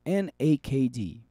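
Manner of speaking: ordinary delivery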